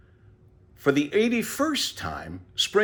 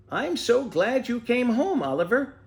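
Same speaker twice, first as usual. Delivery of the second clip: put-on higher, bright young boy voice; young Ted; 11 semitones higher than usual. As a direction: welcome the tortoise home as a glad boy. Boy Ted greets tortoise.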